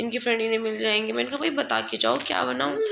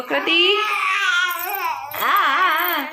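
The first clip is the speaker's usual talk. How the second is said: raised voice calling the little one; 8 semitones higher, 5 dB louder, much slower than usual. Real, not acted. Calling out to baby son. (बच्चे को ज़ोर से पुकारना)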